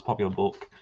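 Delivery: ordinary talk, nothing marked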